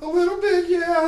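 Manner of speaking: high loud falsetto; squeaky mock girl voice; man doing a woman's voice. Shown as a demonstration. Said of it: "girl voice".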